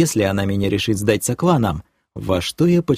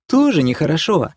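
indignant, high-pitched reproach; flaring up; 7 semitones higher than usual. A narrator's reading. Girlfriend reproaching the thief, indignant. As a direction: bristle straight back up, pitch raised. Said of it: "тут же вновь взвилась".

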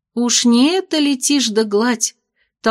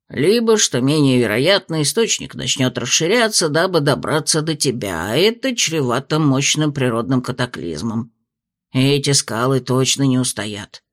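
lower, measured spirit voice; low dragon spirit voice; 8 semitones lower than usual. A narrator's reading. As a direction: speak as a wise old dragon spirit. Spirit continues.

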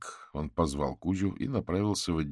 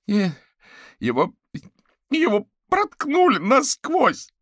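very high, loud, halting hysteria; stumble, then wail it slowly in horror; hysterical; 11 dB louder, slower than usual. Acted. Fetisov tells Averin how the sorcerer was killed, hysterical. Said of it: "В голосе Фетисова появились истерические нотки".

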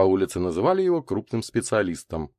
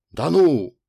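high, loud burst of surprise; surprised; 8 semitones higher, 7 dB louder than usual. A narrator's reading. Толстяк reacts to talk of treasure, surprised. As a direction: react as if caught off guard. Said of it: "удивился Толстяк".

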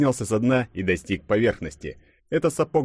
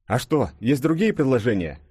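exasperated, raised-pitch question; losing patience; 5 semitones higher than usual. A narrator's reading. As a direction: snap back with mild exasperation. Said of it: "не выдержал Денис". (не выдержав, с лёгким раздражением)

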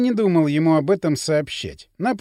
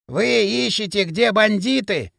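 hoarse disguised voice; 3 semitones higher than usual. Acted. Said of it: "произнёс он хрипловатым, искажённым голосом".

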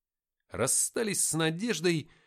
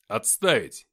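barked command; 2 semitones lower, 9 dB louder, slower than usual. A narrator's reading. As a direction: bark it to silence him. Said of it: "рыкнул я". (громкий рык-приказ)

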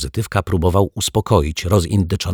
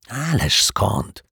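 higher, drawn-out scoff; dismissive snort; 5 semitones higher, much slower than usual. A narrator's reading. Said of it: "prychnął szyld". (przeciągłe, wysokie prychnięcie)

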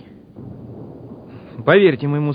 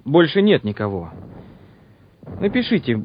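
ordinary delivery

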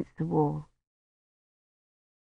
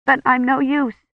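high, straining tearful cry; 7 semitones higher than usual. A narrator's reading. Kivrin weeps over dying Roche, high and tearful. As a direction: fight back the tears.

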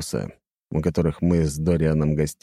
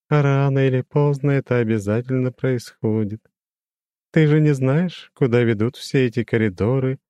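lofty demon with a glassy ring; crystal ringing voice; 7 semitones higher than usual. A narrator's reading. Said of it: "прозвенел он". (высокий звенящий хрустальный голос демона)

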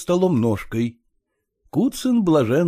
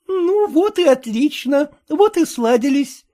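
triumphant; 5 dB louder than usual. A narrator's reading. Aunt Darya triumphant at the settled deal, high. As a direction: gloat over the deal. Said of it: "торжествующе проговорила тётка".

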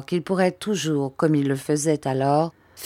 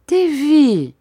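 put-on high, louder call to a child; mother calling; 10 semitones higher, 7 dB louder than usual. A narrator's reading. Mother pleading with her tearful son, high call. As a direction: call his name high, then let it fall.

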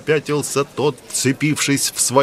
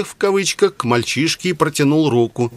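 plain reading voice

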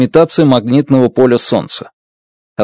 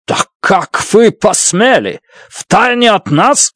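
higher, slow, indignant outburst; flaring up; 7 semitones higher, slower than usual. A narrator's reading. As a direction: snap back in outrage, slowly. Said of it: "вспылил Фокт".

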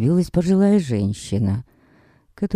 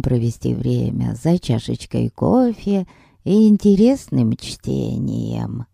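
put-on slow old lady voice. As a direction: reminisce slowly like a kind granny.

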